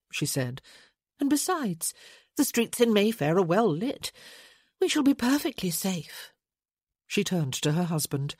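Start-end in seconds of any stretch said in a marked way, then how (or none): 1.2-6.3 lightly voiced lady. A narrator's reading. Francesca voiced by narrator.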